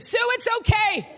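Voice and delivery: put-on high-pitched teenager's call; yelled out. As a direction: call out across an auditorium. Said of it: "yelled out from the back".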